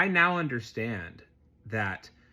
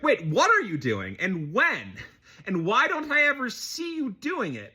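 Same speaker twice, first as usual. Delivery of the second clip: high, louder mock interrogation; incredulous challenge; 10 semitones higher, 4 dB louder than usual. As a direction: act out a kid demanding proof, pitch climbing. Older kid challenges the observer.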